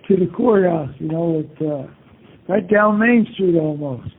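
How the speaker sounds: ordinary delivery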